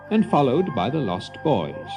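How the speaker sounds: usual speaking voice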